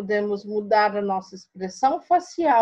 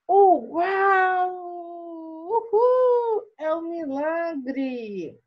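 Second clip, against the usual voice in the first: much higher, whooping cries of joy, then a slow amazed gasp; overjoyed spider; 6 semitones higher, much slower than usual. Shown as a demonstration. Teacher acting the cured spider for the class, ecstatic cheering. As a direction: shout it like a miracle just happened. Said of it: "Tá vendo como mudou a nossa leitura?".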